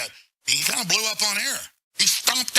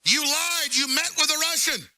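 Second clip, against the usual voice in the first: mocking accusers; 7 semitones higher, slower than usual. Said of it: "And they go".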